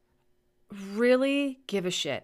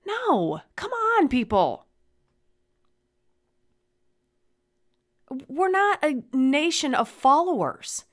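high and loud, pleading with anger; impassioned shout; 7 semitones higher, 7 dB louder than usual. Real, not acted. Calling on her nation, loud outraged plea. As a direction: yell it at the whole country.

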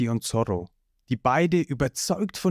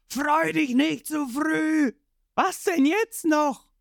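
much higher, slow yell; villain shout; 13 semitones higher, slower than usual. Acted. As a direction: bellow from the ground as Creek. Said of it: "Creek kommt wieder zu sich und schreit".

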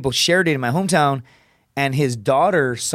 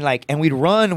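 usual speaking voice

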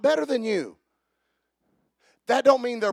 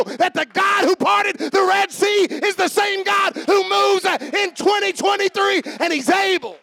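loud, high, fast fiery preaching; shouting sermon, hands laid on; full-force preaching; 8 semitones higher, 7 dB louder than usual. Acted. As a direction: preach it over the shop noise, full throttle.

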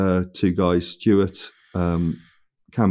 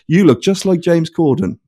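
mock stranger; 9 semitones higher, 3 dB louder than usual. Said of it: "as if that's ever something you say".

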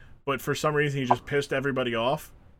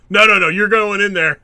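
louder, higher, no arguing; bossy insistence; 4 semitones higher, 8 dB louder than usual. Retold or imitated. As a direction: overrule him firmly. Voicing boss forcing him.

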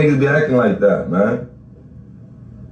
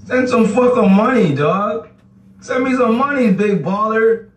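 high-pitched, goading challenge; taunting demand; 10 semitones higher than usual. Real, not acted. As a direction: dare the boaster to pay up. Taunting viewer to send money.

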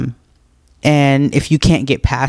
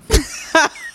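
helpless laughter; 14 semitones higher, 4 dB quieter than usual. Real, not acted. Reacting to a cheeky question.

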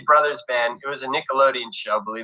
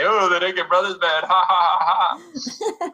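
mocking laugh; 7 semitones higher than usual. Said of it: "People literally say".

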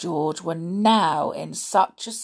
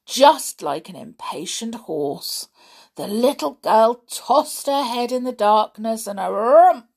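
usual speaking voice

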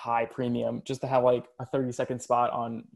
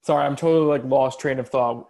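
laggy video call; 8 dB louder than usual. Real, not acted. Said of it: "the leg killed me".

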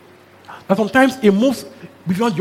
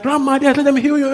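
lightly voiced little girl, higher; a child begging grandma, pitched up; child pleading; 7 semitones higher than usual.

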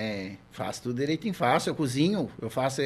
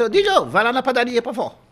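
raised, higher-pitched grandma call; imitated granny hollering, higher and louder; grandmother calling out; 8 semitones higher, 6 dB louder than usual. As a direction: call the grandson over for an errand. Grandmother calls grandson on errand.

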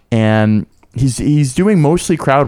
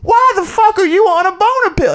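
much higher hammed-up shouting, slow in places; comic yelling in fake shock at the mom; mock outraged comic. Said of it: "he goes".

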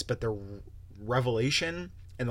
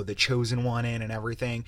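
ordinary delivery

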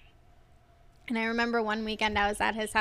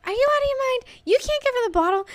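much higher, louder mock shriek; yelling mom; 14 semitones higher, 6 dB louder, much faster than usual. Mom yelling at dad through the phone, high shriek. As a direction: screech at him like a furious mother. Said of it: "from as far away that I was going".

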